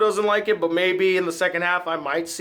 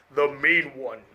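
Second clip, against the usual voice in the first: low Grinch voice; 7 semitones lower than usual. Acted. Outro sign-off.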